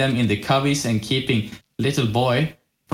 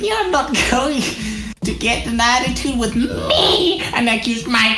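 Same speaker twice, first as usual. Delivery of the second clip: very high cartoonish anime girl voice; rejected anime character. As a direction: squeak it out like an anime heroine. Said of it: "she's still gonna have that voice though".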